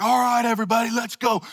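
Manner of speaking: line them up like a drill sergeant; drill sergeant mode. Retold or imitated. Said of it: "drill sergeant mode".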